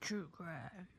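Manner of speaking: a deep movie-trailer delivery. Pretend. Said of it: "That's me and my deep voice".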